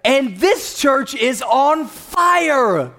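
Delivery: high, loud, drawn-out preacher proclamation; rousing declaration. Real, not acted. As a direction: proclaim it to fire up the congregation. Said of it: "maybe I didn't say it right".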